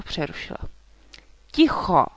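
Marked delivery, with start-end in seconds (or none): 1.5-2.1 loud, huffy order for silence; huffed command. A narrator's reading. Dragon girl silencing the rambling knight, huffing. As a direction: cut them off with an impatient huff. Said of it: "zafuněla".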